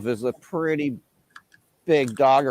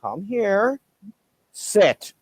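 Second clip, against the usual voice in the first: higher, firm command; commanding the dog; 8 semitones higher, much slower than usual. Retold or imitated. Owner calling his dog back to sit. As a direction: order the dog back sharply. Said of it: "And I'm like".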